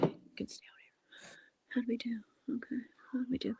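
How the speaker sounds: soft unvoiced whisper; hushed whisper. Real, not acted. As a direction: whisper so no one nearby hears. Whispering out of earshot.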